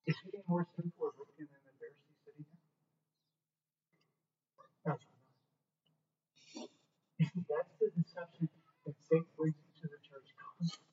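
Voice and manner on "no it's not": hushed answer. Real, not acted.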